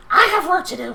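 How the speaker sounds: high, hurried goblin excuse; scurry away in a squeaky voice; a frightened goblin backing down, high-pitched; cowed goblin. Acted. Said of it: "the goblin's like".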